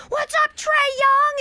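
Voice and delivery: high, drawn-out mock holler; imitated fan yell. Guest imitating somebody yelling outside the Garden, high shout. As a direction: yell it like a rowdy fan at a camera. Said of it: "in one of those yelling videos was like".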